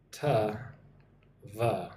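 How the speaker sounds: 'To', then 'the', each alone with a long gap; syllable by syllable. Shown as a demonstration. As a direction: break 'To the' into two separate reduced sounds. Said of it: "notice how to the".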